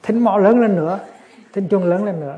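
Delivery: put-on old woman shouting to drown out the caller; mock loud order. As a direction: bark out the order over the chanting. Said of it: "Bà ta".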